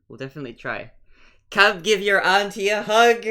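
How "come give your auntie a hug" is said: slow, loud and high, coaxing; warm auntie call. Acted. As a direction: call him over like a doting aunt. Auntie calling to child.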